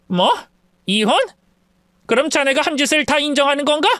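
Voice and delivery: put-on tearing into the drunk son-in-law; angry mother-in-law. (술주정하는 사위를 호되게 꾸짖는 장모)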